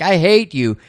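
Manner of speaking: Audrey choking Charlie, retold anger; mock fury. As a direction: spit it out half-acted. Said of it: "She's like".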